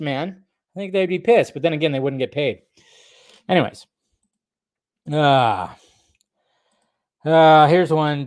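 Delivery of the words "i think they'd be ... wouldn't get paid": usual speaking voice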